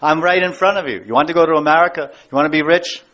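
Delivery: usual speaking voice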